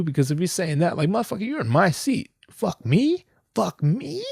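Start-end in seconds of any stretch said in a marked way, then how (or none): 1.1-4.2 mock indignant. Imagined neighbour's meaning.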